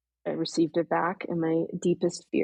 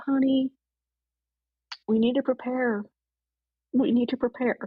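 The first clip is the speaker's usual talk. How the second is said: imitating friend's Southern accent; 7 semitones higher than usual. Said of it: "she has this really nice, like, kind of Southern accent".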